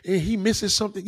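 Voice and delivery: hammed-up high mock of a woman arguing. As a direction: pitch it way up to mimic her. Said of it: "women could argue".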